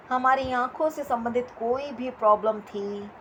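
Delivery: ordinary talk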